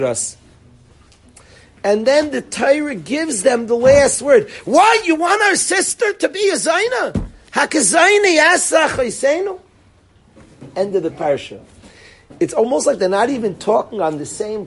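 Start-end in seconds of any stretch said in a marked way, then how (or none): 4.6-9.6 very high, raised-voice protest; screamed retort. Acted. Brothers get the last word with Yaakov, screaming. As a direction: throw back the last word, high and outraged. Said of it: "I'm screaming the pasuk".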